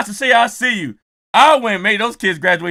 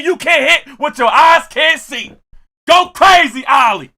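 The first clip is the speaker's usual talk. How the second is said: loud, raised-pitch hype man cheer; yelling at Ali; 8 semitones higher, 6 dB louder than usual. Retold or imitated. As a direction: holler it to fire up a graduate. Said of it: "I'm yelling at Ali".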